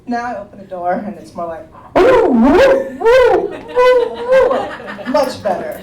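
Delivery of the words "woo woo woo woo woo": high, loud playful woo calls; high birdlike hoots. Retold or imitated. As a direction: coo like happy parrots. Imitating calmer birds.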